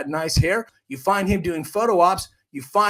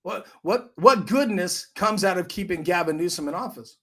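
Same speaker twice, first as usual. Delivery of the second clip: usual speaking voice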